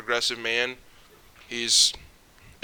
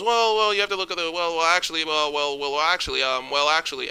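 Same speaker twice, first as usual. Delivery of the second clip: higher, stammering well, well, actually; mocking nerdy know-it-all; 5 semitones higher than usual. Vlogger mocks podcast guest dodging a point, high stammering mock voice. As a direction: lampoon a flustered pedant who keeps saying actually. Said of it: "And he goes".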